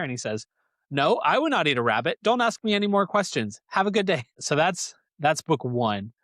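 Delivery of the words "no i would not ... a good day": defensive bear. Retold or imitated. Bear denies eating rabbit.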